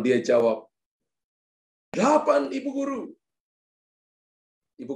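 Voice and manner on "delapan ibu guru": high-pitched schoolboy voice; child's eager answer. Acted. Pupil answering teacher's final sum, eager high voice.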